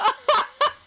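loud, high laugh; burst of laughter. Real, not acted. Friend reacts to stranger asking for number, loud laughter.

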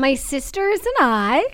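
a hint of a drawling Kourtney Kardashian; Kourtney Kardashian impression. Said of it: "you did that bit right".

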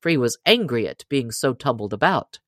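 ordinary delivery, nothing marked

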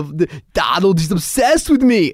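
high, loud, slow imitated complaint; mock ranting. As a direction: play an aggrieved star, stretching the words. Imitates Arnold ranting about tweets.

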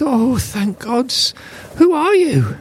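grateful prisoner, voice climbing; relieved dwarf. Acted.

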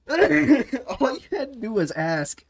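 high, slow words through laughter; laughing tease. Pretend. Co-hosts joking about the stray mouse, laughing tease.

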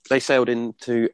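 ordinary talk

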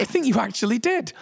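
high, strained inner voice; mock-horrified, high-pitched realisation; horrified disbelief. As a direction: confirm the disaster to yourself, aghast.

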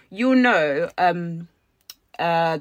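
ordinary talk, nothing marked

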